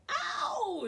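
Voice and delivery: high loud yelp; crying out. A narrator's reading. Aluki crying out as soup burns. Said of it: "she cried".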